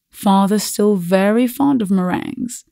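plain reading voice

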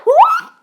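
sudden high yelp as if struck; sharp cry of pain. Real, not acted. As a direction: yelp when the hit lands. Sharp hit in game.

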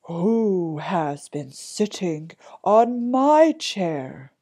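loud, slow big bear; big bear's drawn-out demand; great big voice. A narrator's reading. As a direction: boom it out, stretching each word. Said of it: "cried out in his great big voice".

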